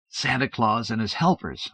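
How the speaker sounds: ordinary delivery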